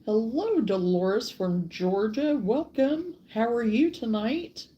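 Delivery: usual speaking voice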